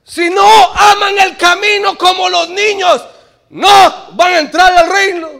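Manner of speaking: loud fervent preaching; rousing sermon climax. Acted. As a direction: preach it with full force to the congregation. Preacher at full force.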